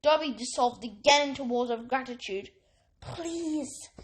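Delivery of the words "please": soft, urgent hush; frantic whisper. A narrator's reading. Harry hushes the sobbing elf, urgent and soft. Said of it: "Harry whispered frantically".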